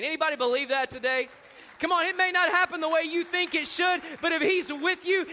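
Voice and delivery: usual speaking voice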